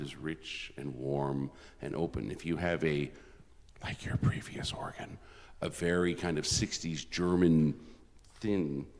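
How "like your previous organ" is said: whispered aside. Real, not acted. A teasing aside to the audience.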